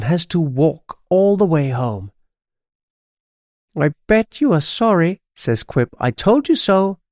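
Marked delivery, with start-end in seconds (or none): 3.8-5.2 higher-pitched gloating magpie; smug. A narrator's reading. Pet magpie gloating at the bruised witch, high and smug. 6.0-7.0 high, lilting gloat; smug. A narrator's reading. Pet magpie proved right, high and smug. Magpie says told you so.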